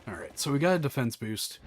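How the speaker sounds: usual speaking voice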